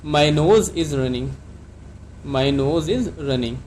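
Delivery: slow, clear model sentence for learners; careful classroom dictation pace; slow teaching model. Shown as a demonstration. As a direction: say it slowly so students can repeat. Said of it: "तो आप इसको कैसे कहेंगे?".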